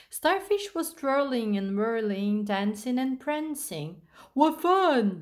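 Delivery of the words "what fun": high, loud gleeful exclamation; giggly delight. A narrator's reading. Smiley Shark watches Starfish dance, giggly delight. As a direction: burst out in playful delight, no real giggle. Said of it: "giggled Smiley Shark".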